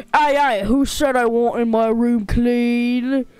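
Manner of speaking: loud, drawn-out mock-British challenge; bad British accent. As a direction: object in a hammed-up British accent. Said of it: "doing a British accent".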